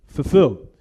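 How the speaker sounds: ordinary talk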